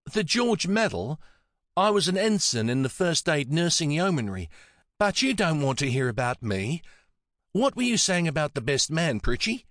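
put-on modest Antipodean old lady. A narrator's reading. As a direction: brush it off in an Antipodean accent. Voices Nancy, modest.

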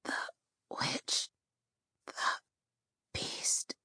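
soft breathless whisper; halting whisper, barely breathing. Acted. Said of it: "the dying girl whispered, barely breathing".

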